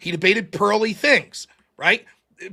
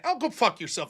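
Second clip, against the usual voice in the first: normal talking voice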